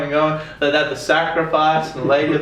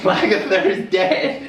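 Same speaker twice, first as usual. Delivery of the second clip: laughing; 10 semitones higher, slower than usual. Real, not acted. Laughing disbelief at character death.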